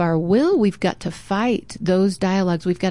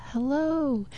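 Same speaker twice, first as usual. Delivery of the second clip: the same hello much softer, like it comes back from far off; faint echo; 6 semitones higher, 7 dB quieter than usual. Retold or imitated. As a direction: let it fade back as an echo. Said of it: "He heard an echo".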